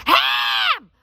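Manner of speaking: high, long holler; hammed-up full-blast yell; comic shouted order. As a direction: scream the word to get through. Repeats the shouted ham order.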